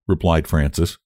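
usual speaking voice

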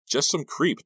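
ordinary delivery, nothing marked

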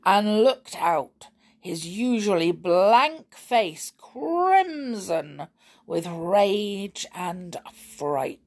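ordinary delivery

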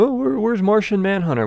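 high-pitched mock wondering voice; wondering crowd. Host voices everyone missing Martian Manhunter, high mock wondering. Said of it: "everyone's wondering".

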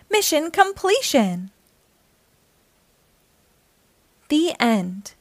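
bright, lively read-aloud voice; reading to children. A narrator's reading. Storyteller reading a picture book aloud to young listeners, lively and bright. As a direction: read it like storytime for little kids.

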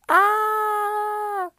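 high, sustained comic scream; one long high shriek, played for laughs; mock scream. Demonstrating a promised scream for the hookup, mock scream.

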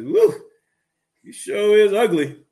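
slow the insult like a cheeky bird; rude parrot. Acted. Parrot in the pet store insulting the woman, loud squawk. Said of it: "The parrot says".

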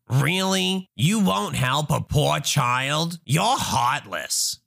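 slow, affronted Karen voice; entitled mother. Acted. Entitled mother shames the traveler for refusing, slow and affronted. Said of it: "Entitled mother".

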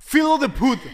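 loud, high mock-Portuguese exclamation. Co-host repeating the joke for laughs, exaggerated Portuguese accent. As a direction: play up a cartoon Portuguese accent.

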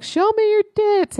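high-pitched imitation of a catcalling drunk; mock drunk heckler. Drunk man shouting from a car window at a woman, mock heckling. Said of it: "this drunk guy rolls his window down".

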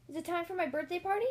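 put-on eager child. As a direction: ask like a kid who can't wait. Child asks excitedly about party.

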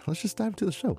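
high, soft silly voice for let's just dive into the show; playful put-on voice. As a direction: pitch it way up and soften, teasing. Redoes the lead-in playfully.

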